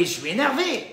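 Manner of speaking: mock exasperated outburst; mock annoyed. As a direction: snap it like someone losing patience. Imitating angry excuse.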